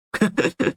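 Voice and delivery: short, high chuckle; amused chuckle. Real, not acted. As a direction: let out a quick string of giggles. Amused at own admission.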